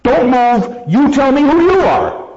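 a sentry challenging an unknown figure; guard's challenge. Acted. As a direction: order the stranger to stand. Acting the sentinel's challenge.

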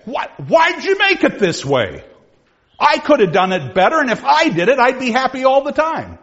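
high, loud, laughing protest; railing at God. Acted. Squire demanding God explain the world, high and loud. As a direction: shake a fist at heaven, half laughing.

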